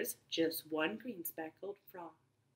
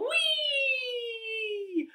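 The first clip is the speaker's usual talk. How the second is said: swooping high whee; high squeal. Pretend. Teacher squeals as frog jumps into pool.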